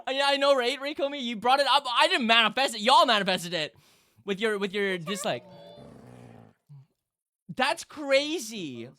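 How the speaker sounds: excited protest. Real, not acted. Streamer defending herself to chat, excited and loud.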